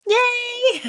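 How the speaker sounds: a high, bright cheer to open the video; excited cheer. Real, not acted. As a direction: squeal it out with joy. Opening cheer to viewers.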